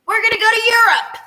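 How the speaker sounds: high, fast, eager announcement; quick high cheery we're off to Europe; excited announcement. Acted. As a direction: announce it like a big reveal. Announcing next continent.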